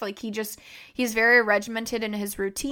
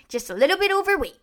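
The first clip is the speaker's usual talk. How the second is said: imitating dad; 9 semitones higher, faster than usual. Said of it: "He would always say".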